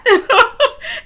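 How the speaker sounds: high-pitched burst of laughter; helpless high cackle; real loud laugh. Real, not acted. Laughing at Kyle's hilarious remark.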